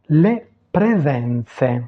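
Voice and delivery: "le presenze" very slow, syllables separated; Italian pronunciation demo. Shown as a demonstration. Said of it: "prononcer les mots". (prononcer « le presenze » très lentement, à l'italienne)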